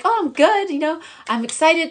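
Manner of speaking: high, chirpy imitation of her own cheerful reply; bubbly cheer. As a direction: sound brightly thrilled for your visitors. Quotes herself answering friends.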